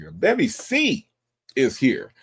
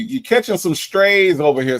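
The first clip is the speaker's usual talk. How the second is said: higher, louder, playful catching; teasing exclaim; 8 semitones higher, 6 dB louder than usual. Real, not acted. Streamer teasing Sonya about the chat, loud playful tease. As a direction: tease her loudly.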